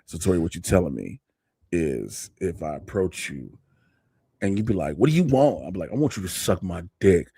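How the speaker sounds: deep voice. Pretend. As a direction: drop the voice way down low. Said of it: "I drop the bass down".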